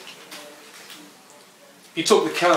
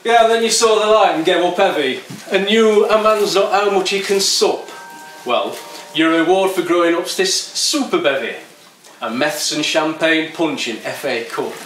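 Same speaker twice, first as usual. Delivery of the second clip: louder, higher mocking yob; sneering skinhead; 5 semitones higher, 4 dB louder than usual. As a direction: taunt the grown-up poet. Skinhead mocks the poet.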